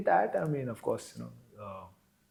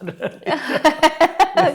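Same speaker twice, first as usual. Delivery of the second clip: loud high laugh; 14 dB louder than usual. Real, not acted. Laughs at own imitation.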